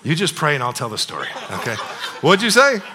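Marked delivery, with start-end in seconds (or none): 2.2-2.8 loud playful comeback. Real, not acted. Answering heckle from crowd.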